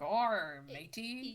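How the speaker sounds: hammed-up pirate Arr matey. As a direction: growl it like a cartoon pirate. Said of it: "He's trying to do a pirate voice".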